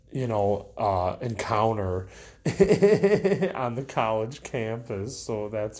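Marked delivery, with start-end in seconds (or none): 2.4-3.5 high, loud laugh; burst of laughter. Real, not acted. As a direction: let a real laugh burst out. Laughs at awkward encounter.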